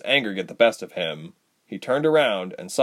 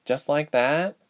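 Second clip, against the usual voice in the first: raised, doubtful question; quizzical; 6 semitones higher, slower than usual. A narrator's reading. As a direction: ask it as if unconvinced. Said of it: "Doe asked quizzically".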